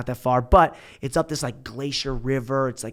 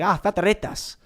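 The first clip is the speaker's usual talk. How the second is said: high-onset attempt at Icelandic; imitated Icelandic phrase; 6 semitones higher than usual. Icelandic teen answering with his motto, imitated Icelandic. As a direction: imitate the kid's Icelandic saying. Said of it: "I can't say it perfect".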